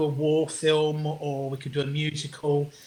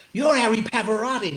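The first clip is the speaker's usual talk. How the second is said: higher-pitched imitation of a fan; excited fan; 5 semitones higher than usual. Diners coming up to the performer, imitated excited recognition. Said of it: "like that".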